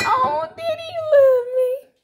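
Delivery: high, squealing thank-you to daddy; overjoyed squeal. Retold or imitated. Daughter thanking her daddy for the almonds, high delighted squeal. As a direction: gush like a thrilled little girl. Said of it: "Y'all, I was so happy".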